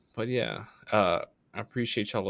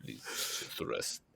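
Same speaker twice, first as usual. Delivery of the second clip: whispered deep lullaby, breathy pleading; hushed lullaby; 11 dB quieter than usual. Pretend. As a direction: lull him back to sleep in a deep murmur.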